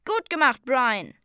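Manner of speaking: loud, high congratulating call; hearty praise. A narrator's reading. Zayden praising Brian's shot, loud high call.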